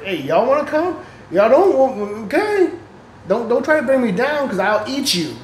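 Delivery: high, mock menacing warning; playful threat. Warning the darkness not to drag him down, playful mock threat. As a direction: tease them that you'll eat them, half laughing.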